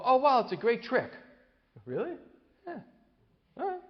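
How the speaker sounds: lightly voiced upbeat grandma; cheery grandmother. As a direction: brighten it up to distract the child. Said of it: "She'll go".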